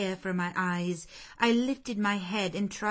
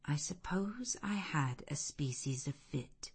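soft unvoiced whisper; 2 semitones lower, 7 dB quieter than usual. A narrator's reading. Narrator recalling fainting in the red room, whispering. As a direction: let it fade to a breath.

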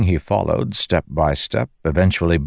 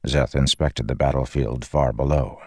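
ordinary delivery, nothing marked

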